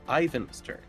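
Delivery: normal talking voice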